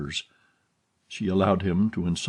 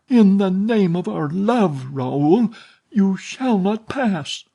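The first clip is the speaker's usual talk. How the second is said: much higher, louder, slowing on the final command; desperate plea; 11 semitones higher, 5 dB louder, slower than usual. A narrator's reading. Heroine barring the door against her lover, desperate plea. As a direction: bar his way with all your heart.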